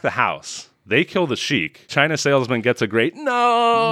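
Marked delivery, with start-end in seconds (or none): 3.2-3.9 high, drawn-out "no" wail; hammed-up cry of despair; mock anguished cry. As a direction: imitate a melodramatic movie scream. Acting out the salesman's big cry.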